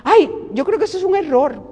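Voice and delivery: high, sneering know-it-all voice; catty gossip. Retold or imitated. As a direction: imitate the envious critic dismissing the book. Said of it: "Y empezaron a hablar".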